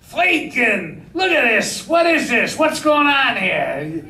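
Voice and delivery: put-on high-pitched, shouted thick Austrian accent. Studio boss shouts at the director on set, thick Austrian accent. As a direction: imitate a billionaire hollering in a thick Austrian accent. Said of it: "had a very thick Austrian accent. And he shouted out at me".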